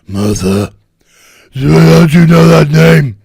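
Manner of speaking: hammed-up movie-hero bellow; parody enraged roar. Parodies a film's outburst at "Martha.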